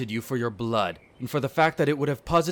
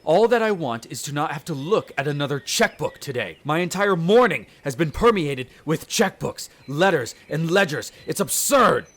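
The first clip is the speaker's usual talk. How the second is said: building louder and higher into an indignant outburst; exasperated rant; 5 semitones higher, 8 dB louder than usual. Acted. Vampire complaining to the human about his morning, rising exasperated rant. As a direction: vent your outrage at the paperwork.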